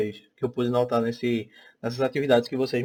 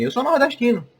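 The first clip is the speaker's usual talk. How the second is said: Ariano Suassuna; 10 semitones higher than usual. Retold or imitated. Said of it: "um vídeo muito famoso de Ariano Suassuna que ele diz assim".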